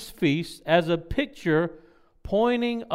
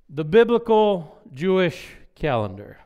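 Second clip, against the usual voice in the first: louder, measured announcement of a heading; emphatic dictation; 6 dB louder than usual. Real, not acted. Teacher announcing point four to the class, emphatic and deliberate. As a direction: spell out the heading word group by word group, loudly.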